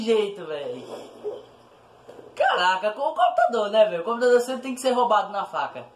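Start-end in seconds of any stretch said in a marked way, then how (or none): none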